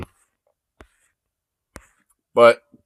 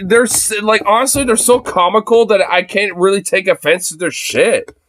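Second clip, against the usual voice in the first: ordinary talk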